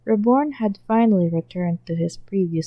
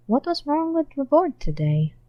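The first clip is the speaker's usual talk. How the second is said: ordinary delivery, nothing marked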